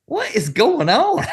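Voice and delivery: high, slow, baffled question. Retold or imitated. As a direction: ask it in total disbelief. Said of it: "You're like".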